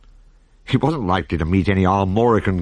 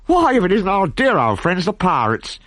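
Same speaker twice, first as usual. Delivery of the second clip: higher, cheery delighted greeting; bright mock-delighted welcome; delighted exclaim; 10 semitones higher than usual. A narrator's reading. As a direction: hail old friends gleefully. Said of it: "exclaimed Asterix, delighted".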